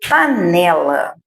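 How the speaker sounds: a low, lengthened "Panela"; palavra longa. Shown as a demonstration. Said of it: "Eu vou usar o palitinho". ("Panela" demorado e mais grave)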